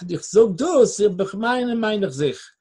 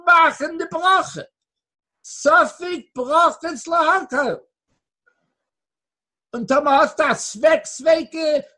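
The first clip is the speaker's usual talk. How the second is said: higher, louder sermon voice, driving the point; fiery preaching; 6 semitones higher, 6 dB louder than usual. Acted. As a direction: preach it out hard to the room. Preaching at full force. (mit voller Kraft predigen)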